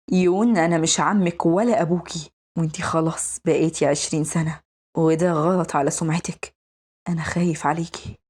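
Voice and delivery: low, measured male guardian voice; stern protective guardian. Acted. Guardian telling his grown-up ward she must leave, low and firm.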